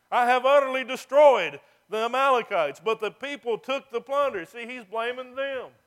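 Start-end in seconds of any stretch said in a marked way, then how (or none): 0.1-4.4 loud, higher claim pushing the blame; proud, then point the finger; blame-shifting boast. Retold or imitated. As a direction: Saul shifting blame onto the people. Saul blaming the people.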